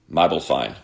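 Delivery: "my bull fine" quickly run together like Aussie "mobile phone"; said really fast. Retold or imitated. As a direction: blend "my bull fine" into one quick phrase. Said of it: "Say it really fast".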